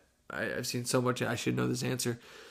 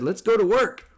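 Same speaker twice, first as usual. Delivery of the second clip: higher and louder, charged with anger; fired-up declaration; 8 semitones higher, 6 dB louder than usual. Retold or imitated. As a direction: psych yourself up, angry and ready. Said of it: "And I said".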